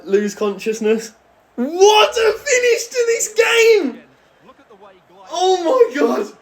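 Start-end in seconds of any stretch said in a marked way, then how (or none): 1.6-6.3 high, slow, stretched shout of joy; ecstatic shout. Real, not acted. Gamer hailing the winning finish, stretched joyful shout. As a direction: yell it out, savouring every word.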